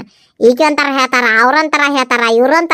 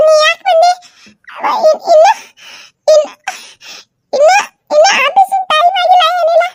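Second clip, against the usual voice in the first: higher, nervous laughing woman; higher voice, flustered and laughing; 13 semitones higher than usual. Acted. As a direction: caught off guard, stammering in a high voice. Same high character, flustered.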